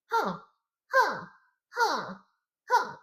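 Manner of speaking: short, high-pitched feminine grunts; put-on female "huh" of being hit; quick high grunts for a game character. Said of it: "kind of female voice I can make".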